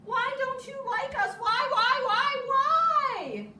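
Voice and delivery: high, loud sing-song calling. A narrator's reading. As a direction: call down into the hole. Animals call into the hole.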